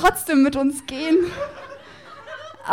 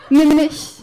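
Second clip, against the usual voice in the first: louder, laughing plea; 3 semitones higher, 8 dB louder than usual. Acted. Poet on stage begging Justus again, loud and laughing. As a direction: belt it out with a laugh.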